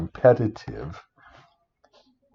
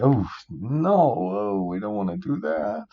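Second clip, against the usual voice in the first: pitched way up, a whiny mock groan; mock dismay; 9 semitones higher than usual. Teacher balking at a fund, higher mock whine. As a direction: squeal it out like a kid refusing. Balks at a renewable energy fund.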